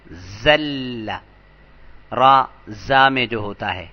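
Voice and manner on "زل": زل with a clear buzzing z; plain z sound. Shown as a demonstration. Teacher showing students the plain z sound. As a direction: say زل as "zal" with an ordinary z. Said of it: "زا کے ساتھ".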